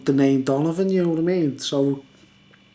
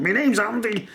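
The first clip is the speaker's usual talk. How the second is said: mimicking Andy; 6 semitones higher than usual. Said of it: "he was going".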